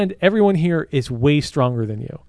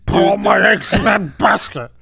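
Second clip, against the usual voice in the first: high-pitched family bit; slower than usual. Acted. Son thanks Pop in the family business bit, mock high-pitched wail. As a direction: squeal it with hammed-up heartbreak. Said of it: "High-pitched [name]".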